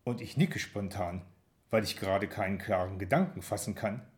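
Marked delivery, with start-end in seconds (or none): none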